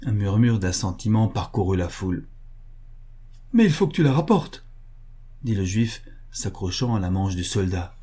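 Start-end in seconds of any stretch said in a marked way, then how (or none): 3.5-4.7 high-pitched, urgent condition; anxious insistence. A narrator's reading. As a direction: insist while clinging to the soldier. Butcher clings to soldier, setting condition.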